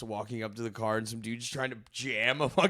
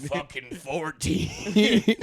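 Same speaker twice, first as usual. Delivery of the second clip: slow drawl; 4 semitones higher, 4 dB louder, much slower than usual. Retold or imitated. Callback to the cop line.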